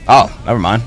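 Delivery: ordinary talk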